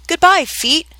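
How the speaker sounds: high-pitched farewell call; calling goodbye. A narrator's reading. Alice to her vanishing feet, calling goodbye. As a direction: call it out to someone far below.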